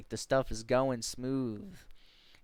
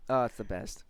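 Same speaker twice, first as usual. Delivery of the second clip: usual speaking voice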